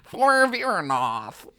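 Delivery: high, slow nerdy dragon-helper voice; nerd burglar voice. Retold or imitated. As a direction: drag it out like an eager nerd. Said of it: "cursed him with this nerd burglar voice".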